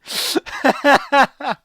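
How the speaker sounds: high, loud laughing fit; helpless laughter. Real, not acted. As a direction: laugh till it hurts.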